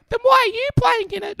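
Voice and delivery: put-on high kid voice talking back; little kid talking back to the big player; cheeky kid. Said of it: "the little kid looked at me and went".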